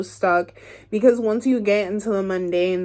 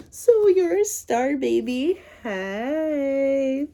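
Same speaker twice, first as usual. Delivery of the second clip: playful sass; 6 semitones higher, much slower than usual. Real, not acted. Playful praise to the viewer.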